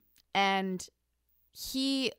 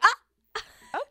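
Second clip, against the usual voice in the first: surprised realization; 14 semitones higher, 7 dB louder than usual. Retold or imitated. Mock realization.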